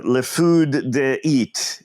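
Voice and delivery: slow, grand fake French; mock French accent. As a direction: say it like a fancy French menu. Making up a fake fancy French name.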